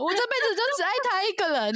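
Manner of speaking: high, fast, hammed-up tearful vow; melodramatic teen. 嘲讽高中女生演戏时, 夸张的偶像剧哭腔. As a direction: overact like a soap-opera heroine. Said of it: "真的在那边演那种".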